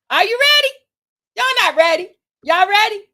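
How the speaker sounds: high, loud call to the crowd; "are you ready" shouted high and loud; hype call. Real, not acted. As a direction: pump up the audience like a host. Hyping up the audience for big news.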